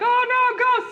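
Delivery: high, loud hammed-up fright; imitating a terrified group; mock scared yelp. Ghost Terry mimics the Wednesday group's fright, hammed-up. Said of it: "they were like".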